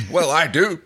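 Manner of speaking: loud, high-pitched interjection; Rigel character. A narrator's reading. Rigel speaks up about snatching, loud and high. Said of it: "Rigel spoke up".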